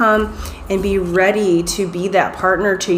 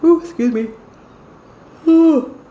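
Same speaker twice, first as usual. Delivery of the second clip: yawning mid-sentence; 9 semitones higher than usual. Real, not acted. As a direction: let a big yawn break through. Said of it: "I'm yawning".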